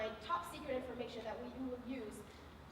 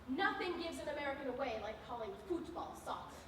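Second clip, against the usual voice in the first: ordinary talk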